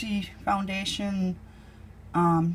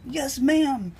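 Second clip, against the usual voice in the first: light, higher imitation of a Boston clerk; Boston accent; 7 semitones higher than usual. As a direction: play the polite Boston fish guy with a touch of accent. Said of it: "'cause they have a Boston accent".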